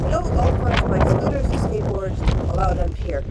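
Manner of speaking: loud, slowing sign reading; loudly reading a pier sign. Real, not acted. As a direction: read the sign out loud like an announcement. Reading sign aloud emphatically.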